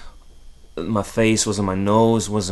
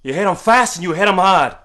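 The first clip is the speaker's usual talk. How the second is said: higher, louder barked order; father yelling; 9 semitones higher, 8 dB louder than usual. Retold or imitated. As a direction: drill the lesson in, shouting. Father orders son to fight.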